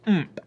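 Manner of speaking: high, punchy little grunt; emphatic "ump". Shown as a demonstration. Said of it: "give that little bit of a".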